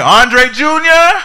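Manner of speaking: long, very high and loud summons; stern father calling. Retold or imitated. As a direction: call the boy's name like a dad about to punish.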